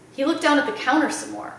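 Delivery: plain reading voice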